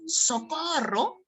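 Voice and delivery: high, drawn-out mock cry; restrained play-acted distress call; mock cry for help. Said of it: "Cuando ella grita".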